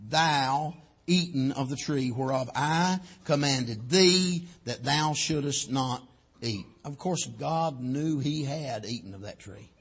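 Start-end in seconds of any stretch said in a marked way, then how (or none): 0.1-6.7 slow, deliberate scripture question; solemn, measured questioning; slow, weighted reading. Acted. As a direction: read it word by word, weighing each one. Reads God's question, weighting words.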